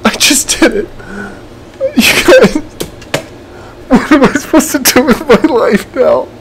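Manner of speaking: very high, half laughing, half crying; elated tearful. Real, not acted. Gamer celebrating two-year challenge win, tearful elation. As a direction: let the joy spill over, voice cracking.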